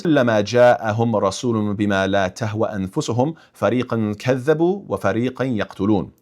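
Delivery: fast, chanted Arabic recitation; Quranic recitation. Acted. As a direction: recite the verse as in prayer.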